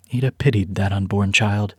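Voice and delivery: ordinary delivery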